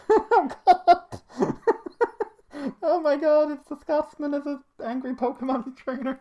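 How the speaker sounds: high, laughing exclaim; recognizing the meme, laughing. Real, not acted. Laughing excitement.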